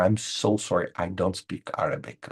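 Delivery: ordinary talk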